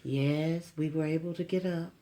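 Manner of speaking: lightly voiced smooth radio announcer. A hint of the mother-in-law's announcer voice on the phone. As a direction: imitate a gentle radio announcer. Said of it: "radio announcer voice".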